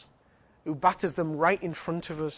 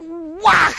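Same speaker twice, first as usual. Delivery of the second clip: mock whack; 12 semitones higher, 10 dB louder than usual. Acting out the punch.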